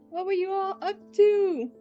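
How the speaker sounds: high loud cheerful ask; bubbly excited question; cute anime streamer. Real, not acted. Greeting raiders.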